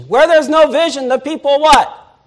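ringing pulpit question; preaching. Acted. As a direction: call it out to the congregation. Preacher asks congregation, full voice.

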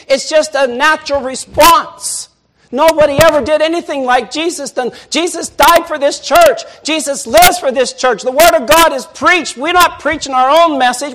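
loud, high fervent preaching; full-force pulpit preaching. Acted. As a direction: preach it out to the whole room.

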